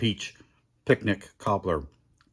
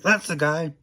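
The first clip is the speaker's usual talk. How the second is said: annoyed staff voice; 10 semitones higher, 4 dB louder, slower than usual. Retold or imitated. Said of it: "They're like".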